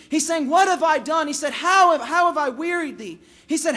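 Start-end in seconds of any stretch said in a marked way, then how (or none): 0.5-1.3 raised, loud, urgent plea; preacher voicing God's plea; pleading. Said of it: "God here is pleading". 1.5-3.2 high, loud, insistent question; impassioned preacher's plea; pleading. Acted. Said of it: "God here is pleading".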